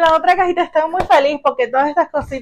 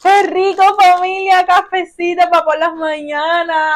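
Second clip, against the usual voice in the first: high, excited exclamation easing slightly; emotion bursting out; 3 semitones higher than usual. Real, not acted. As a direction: gush with real delight, barely holding back a shout. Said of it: "la emoción no me deja". (con emoción desbordada)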